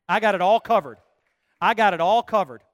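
loud, ringing declaration; emphatic proclamation. Acted. Preacher voicing Jesus to the congregation, loud and sure. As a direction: proclaim it as an unshakable promise.

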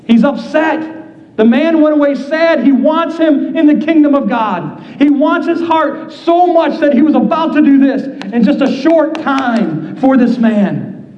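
ordinary talk

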